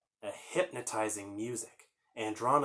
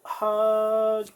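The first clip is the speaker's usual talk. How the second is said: excited hug; 10 semitones higher, 9 dB louder than usual. Acted. Virtual hug to viewers.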